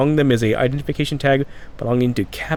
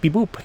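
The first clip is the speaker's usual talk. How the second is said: high little beep-boop; playful robot bleeps by mouth; imitated computer beeps; 7 semitones higher than usual. Player showing how they hack, imitated beep-boop. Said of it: "That's how I hack".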